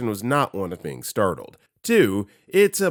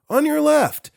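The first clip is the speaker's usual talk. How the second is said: loud, very high trail warning; high, clear cyclist's shout; called-out heads-up; 6 dB louder than usual. Acted. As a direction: call out brightly to someone ahead. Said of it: "I gave the classic heads up".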